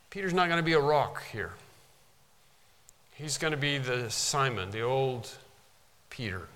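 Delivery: ordinary talk, nothing marked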